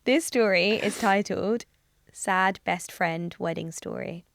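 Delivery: slow dramatic title read. Pretend. Reads title with mock solemnity.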